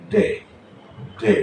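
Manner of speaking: ডে with the tongue on the upper gum, a firm d; d sound demo. Shown as a demonstration. Teacher drilling ডে for pupils, tongue on the gum. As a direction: tap ডে off the gum ridge. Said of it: "রাখার পরে উচ্চারণটা বেরোবে, ডে".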